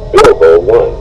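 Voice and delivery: ordinary delivery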